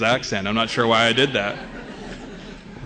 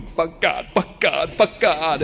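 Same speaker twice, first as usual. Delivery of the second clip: terrified muttering; 5 semitones higher, slower than usual. Retold or imitated. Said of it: "holding onto my seat going".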